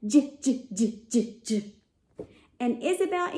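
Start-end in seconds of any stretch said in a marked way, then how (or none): 0.1-1.7 j repeated as a bouncy jiggling sound; j, j, j like a laughing jellyfish; J sound for kids; letter J sound. Shown as a demonstration. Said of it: "the sound of the letter J".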